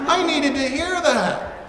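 high, loud and slow, full of feeling; gushing praise. Pretend. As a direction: gush like a moved listener. Gushing congregant, repeated.

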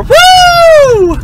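high, loud cheer; celebrating the time on the clock; excited whoop. Real, not acted. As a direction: whoop with delight. Cheering at making it.

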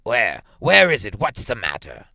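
loud, startled rapid-fire questions; startled cry that drops by the last question; alarmed. A narrator's reading. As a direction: jolt awake shouting in alarm. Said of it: "He started up in alarm".

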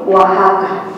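ordinary talk, nothing marked

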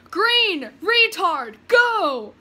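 furious shouts at the car ahead; road rage. Acted. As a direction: yell at traffic. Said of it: "in my road rage".

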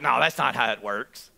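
very low grumbled disagreement; muttered objection. Acted. Woman objecting to the centurion's story, low muttered aside. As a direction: grumble it low, under your breath.

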